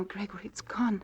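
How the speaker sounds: a frightened breath of his name; stricken whisper. Acted. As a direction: whisper it as the loss sinks in. Wife realizes brooch is lost.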